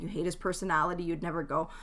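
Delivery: normal talking voice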